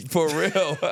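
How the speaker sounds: high-pitched words through laughter; real laughing agreement. Real, not acted. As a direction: crack up while agreeing. Cracks up at the simp remark.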